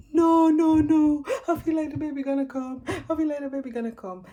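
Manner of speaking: high, loud cries fading down; screaming. Retold or imitated. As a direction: scream that the baby is coming. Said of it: "I kept screaming and saying".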